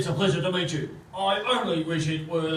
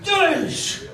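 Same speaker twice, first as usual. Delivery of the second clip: much higher, louder yeesh; exasperated yelp; 4 dB louder than usual. Acted. Chief fed up with the endless interruptions, high yelp. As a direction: yelp in disbelief.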